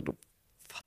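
breathless whispered half-swear; voiceless muttered swear word; whispered curse. Retold or imitated. Cursing the doctor under breath.

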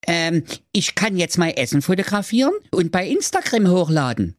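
high, proud son voice; young son voice. Acted. Son telling father his trade, high-voiced. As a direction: show off a silly new skill. Klaus one answers father.

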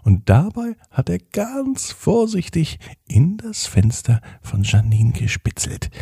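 ordinary delivery, nothing marked

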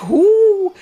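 high loud mock-startled hu; surprised gasp. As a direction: act startled by the ghost. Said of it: "Und dann macht sie auf und sagt".

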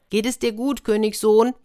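calling out. Acted. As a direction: call to him from outside the door. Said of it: "rief gelbe Lilie".

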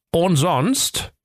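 high, expectant storybook cop; prompting inspector. A narrator's reading. Inspector prompts his officer.

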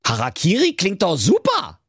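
high, cheerfully callous; cheeky web designer. Acted. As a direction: toss it off brightly. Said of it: "sprach da Lars Lutz Loderhose".

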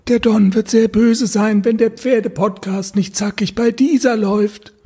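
high, put-on mafia henchman voice; disguised voice. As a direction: threaten like a mobster in a fake voice. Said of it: "hab so mit verstellter Stimme so".

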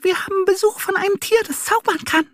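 tiny high mouse voice, fast; whispering mice. A narrator's reading. Mice whispering about the visitor, tiny and fast. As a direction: squeak it out quickly like a mouse. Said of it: "und wispern".

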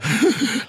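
high giddy squeal of a starstruck fan; mock fangirl squeal; excited squeal. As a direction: squeal like a fan spotting her idol. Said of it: "ist so".

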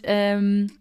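ordinary delivery

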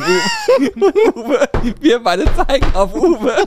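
speaking through real fits of laughter, pitch jumping high; can barely get the words out for laughing. Real, not acted. Retelling the captain moment.

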